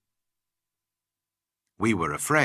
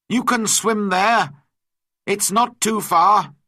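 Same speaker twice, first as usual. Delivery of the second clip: high, loud shout to a swimmer; shouted warning; 10 semitones higher, 6 dB louder than usual. A narrator's reading. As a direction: call it out forcefully over the water. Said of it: "I shouted to him".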